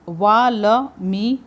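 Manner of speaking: वा ल मी spelled out very slowly, syllable by syllable. Shown as a demonstration. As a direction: break वा ल मी into slow separate syllables. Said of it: "Valmiki". (अक्षर-अक्षर धीरे-धीरे)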